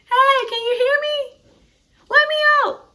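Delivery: high, pleading voice calling from inside; inner little voice. Retold or imitated. Inner voice begging to be let out, high and pleading. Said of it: "that little voice like".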